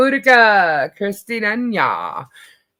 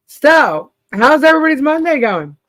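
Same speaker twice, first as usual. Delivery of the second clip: upbeat; 8 semitones higher, 5 dB louder than usual. Real, not acted. Bright host opener.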